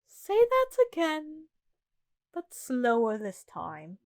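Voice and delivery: slow, starting high then dropping; slow, measured demand. Acted. Queen asking the cook to repeat it, slow and measured. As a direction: draw the order out slowly.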